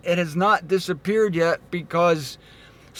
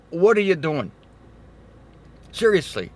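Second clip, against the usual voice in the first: ordinary talk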